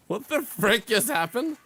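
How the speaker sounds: loud and slow, stunned; shocked outburst. Real, not acted. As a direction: yell it in disbelief. After sudden in-game event.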